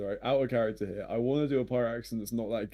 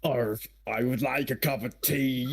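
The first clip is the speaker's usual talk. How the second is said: slow, over-the-top British pirate; bad pirate accent; slower than usual. Shown as a demonstration. Player imitates Dexter's pirate impression, slow bad British pirate accent. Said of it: "impression of Dexter doing an impression of a pirate".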